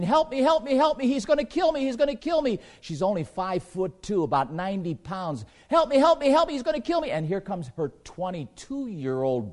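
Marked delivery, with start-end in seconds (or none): 0.0-2.6 high, louder panicked cries for help; mock terrified scream. Preacher imitates a victim begging bystanders, mock panicked scream. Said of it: "woman screaming". 5.7-7.1 high, louder panicked cries for help; mock terrified scream. Preacher imitates a victim begging bystanders, mock panicked scream. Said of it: "woman screaming".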